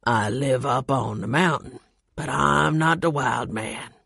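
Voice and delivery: lightly voiced gruff mountain man; low-voiced Carl.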